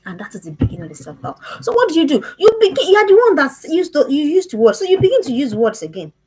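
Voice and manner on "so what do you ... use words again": louder, higher, pushing on; rally the parents with force; rousing exhortation. Real, not acted. Urging parents to build up.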